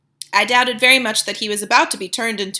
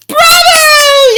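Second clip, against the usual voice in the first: high, loud cheering witch; crowd yelling; 12 dB louder than usual. A narrator's reading. Witch audience cheering their leader. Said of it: "yelled the audience".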